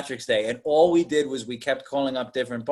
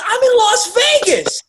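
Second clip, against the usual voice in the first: high, louder mock call girl; 6 dB louder than usual. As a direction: play the call girl, pitched way up. Prostitute voiced in retelling.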